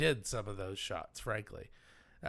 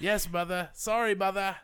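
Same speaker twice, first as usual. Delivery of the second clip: lightly voiced henpecked son, a little higher; whipped son; 6 semitones higher than usual. Mama-whipped Lionel apologising to his mother, meek reply. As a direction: answer like an obedient mama's boy. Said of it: "He's, he's whipped".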